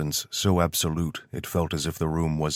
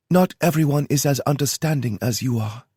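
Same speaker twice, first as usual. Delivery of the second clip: put-on higher, kind young woman's voice; kindly Kyoto; 10 semitones higher, 5 dB louder than usual. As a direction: speak gently, like reassuring a friend. Kyoto's voice again.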